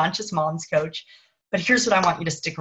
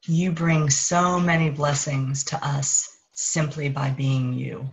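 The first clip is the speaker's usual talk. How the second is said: higher consciousness voice. Acted. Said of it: "the voice of higher consciousness".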